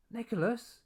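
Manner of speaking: sharp high call of a name. A narrator's reading. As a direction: mum calls son from the doorway, loud and alarmed. Mum calling out sharply.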